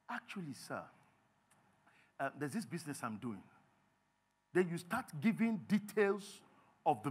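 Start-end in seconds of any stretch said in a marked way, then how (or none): 0.1-3.4 low, soft, hesitant pitch; hushed, respectful asking; deferential borrower. Acted. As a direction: open the request humbly and carefully. Borrower nervously approaches formal lender.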